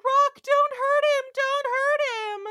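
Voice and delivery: high, loud, pleading yell. Retold or imitated. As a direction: cry out after him, begging him to stop. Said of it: "follows out the door yelling".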